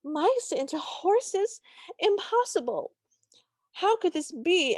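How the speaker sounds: high, drawn-out mock disbelief; incredulous Cinderella. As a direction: imitate an astonished heroine, pitched well up. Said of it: "And Cinderella goes".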